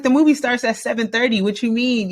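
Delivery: high, quick disbelieving pushback; incredulous protest. Retold or imitated. Teen protesting the parents' seven o'clock curfew, high and disbelieving.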